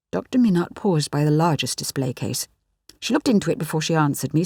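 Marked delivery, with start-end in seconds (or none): none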